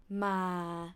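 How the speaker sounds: Ma said low and dipping; low tone demo. Shown as a demonstration. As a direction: drop the voice on Ma. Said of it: "low tone".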